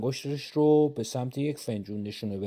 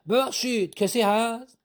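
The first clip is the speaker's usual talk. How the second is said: higher, polite gentleman's voice; refined young collector; 9 semitones higher than usual. Acted. Disguised collector calling into the shop, higher and polite. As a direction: call in politely at the door.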